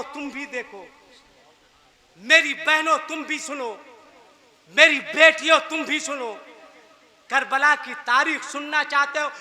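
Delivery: ordinary delivery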